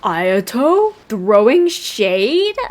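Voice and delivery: drawn-out, incredulous questions with rising ends; playful disbelief. Real, not acted. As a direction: stretch it out, scandalized at the gossip. Reacting to character's unexpected insult.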